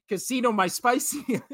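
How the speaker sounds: giggling while teasing; laughing. Real, not acted. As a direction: laugh through the words. Laughs before spicy take.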